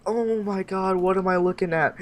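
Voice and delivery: high, shocked disbelief. Retold or imitated. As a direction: recoil at what you see. Sees man in boxers.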